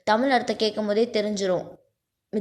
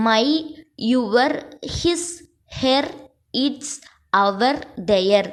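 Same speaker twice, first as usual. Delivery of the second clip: word by word, with a clear pause after each; measured dictation, spaced out for copying; dictating; 3 semitones higher, much slower than usual. Real, not acted. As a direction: dictate the list one word at a time. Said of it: "நான் dictate மட்டும் தான் பண்றேன்".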